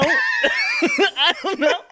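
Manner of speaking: very high, drawn-out laughing cry; laughing exclamation. Real, not acted. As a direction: stretch it out high and loud through laughter. Comic baffled outburst after own question.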